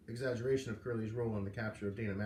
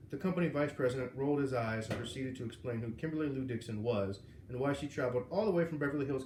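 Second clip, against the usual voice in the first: ordinary delivery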